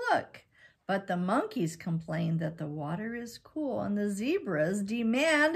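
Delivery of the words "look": ordinary delivery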